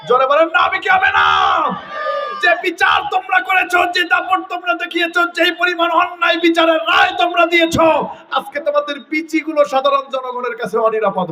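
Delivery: shouted sermon, pitched high; fiery preaching. Acted. Preacher warning the judges before the crowd, shouting high. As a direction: thunder it at the crowd.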